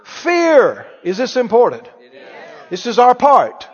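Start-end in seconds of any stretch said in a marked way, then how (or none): 0.0-0.8 loud, raised, very drawn-out proclamation; preacher proclaiming scripture; forceful declaration. Real, not acted. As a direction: ring out each word slowly to the congregation.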